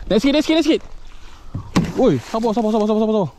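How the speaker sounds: high, hurried repeated commands; urgent pleading. Real, not acted. Angler urging patience in the fight, urgent. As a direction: urge them in a high, pressed voice.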